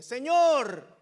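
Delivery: loud, high anguished call to God; crying out. Acted. Preacher voices a believer calling on God, crying out. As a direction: cry it out as a desperate plea. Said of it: "hemos clamado en forma de pregunta".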